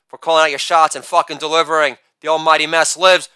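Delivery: usual speaking voice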